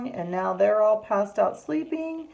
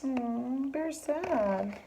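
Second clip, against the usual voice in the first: soft, high, sympathetic coo; pitying aw; 6 semitones higher, 4 dB quieter, much slower than usual. Acted. Teacher shows children the sad bear, soft pitying voice. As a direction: feel sorry for the poor bear, softly.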